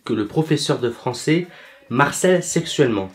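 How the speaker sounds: ordinary delivery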